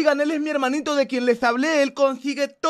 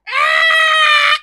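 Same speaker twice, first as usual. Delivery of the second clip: long, loud, high battle cry; effort yell; 13 semitones higher, 6 dB louder than usual. Acted. Mother hitting with all her strength, effort yell. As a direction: yell while putting everything into a blow.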